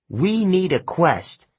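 rally them to act, stretching the words; urgent appeal. Acted. Urging the campers to act.

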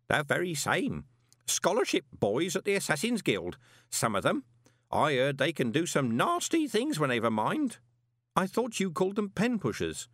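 put-on high, chatty old clerk; fussy old postal clerk; old man voice. Groat's voice in dialogue.

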